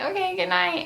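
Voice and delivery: high, forced brightness; awkward goodbye. Retold or imitated. Leaving her date at the door after he refused, a forced high goodnight. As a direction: cover the hurt with a chirpy goodnight.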